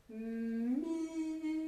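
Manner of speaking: MI as "mmmiiii", the I held long and high; stretched-out sounds. Shown as a demonstration. Teacher helps pupils hear what follows M, stretched-out sounds. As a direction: draw out "Mi" so the vowel after M rings on. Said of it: "écouter ce qui se passe après le m".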